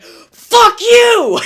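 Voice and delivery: screaming at the top of lungs; shout. Retold or imitated. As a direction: yell it with all the rage inside. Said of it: "inside I was like".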